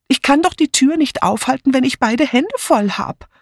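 put-on high, thin old lady voice; fretful elderly woman; frail great grandma. A narrator's reading. Old Amy complaining, voiced higher.

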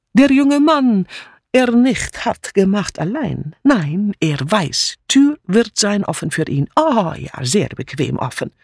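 put-on heavy foreign accent, swooping pitch; agitated refugee maid with a sarcastic edge; insinuating foreign maid. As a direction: insinuate knowingly in broken, accented German. Mitzi insinuates in broken German.

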